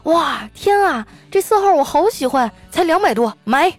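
high-pitched shopper's squeal of delight, first word drawn out; delighted gushing. Acted. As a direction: gush over a lipstick you love. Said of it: "哎，我们会高兴".

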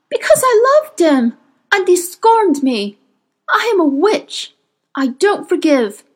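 high, fierce witch's retort; impassioned witch. A narrator's reading. Witch at knifepoint defending her killing, impassioned outburst. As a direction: fling it back proudly, pitched well up. Witch answers Will's shouted accusation.

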